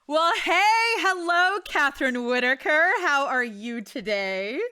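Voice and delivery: host welcoming her guest with a loud, excited hello; excited greeting. Real, not acted. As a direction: greet your guest with big energy.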